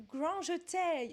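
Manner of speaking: "grand jeté" slowly in a mock English accent. Quiz reader playfully anglicises a ballet term, English accent. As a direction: say "grand jeté" like an English speaker, ending "-tay". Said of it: "le dire avec un accent anglais".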